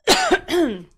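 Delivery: loud, short cough; hard cough to clear a dry throat; real cough. Real, not acted. Host needing water mid-show, real cough. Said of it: "I haven't coughed all day".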